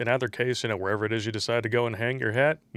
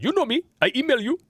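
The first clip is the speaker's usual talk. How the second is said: high, loud mock Indian accent; 14 semitones higher, 4 dB louder than usual. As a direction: read it in a playful Indian accent. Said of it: "in an Indian accent".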